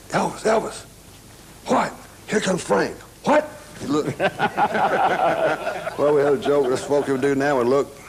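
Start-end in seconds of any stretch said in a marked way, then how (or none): 0.1-3.5 urgent mock warning. Prank call to Elvis in trailer. 4.2-5.9 bursts out laughing at his own prank; a full, high laugh; laughing while telling the story; real laugh. Real, not acted.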